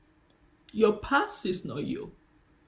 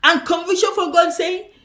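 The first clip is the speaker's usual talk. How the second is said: preaching at full force, louder and higher; 4 semitones higher, 10 dB louder than usual. Acted. Preacher warning the church against shame, loud preaching. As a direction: hammer the contrast home.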